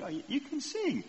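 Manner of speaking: high-pitched imitation of an amazed daughter; surprised daughter. Daughter hearing parent singing yesterday, retold with high surprise. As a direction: mimic pleasant surprise, pitched well up.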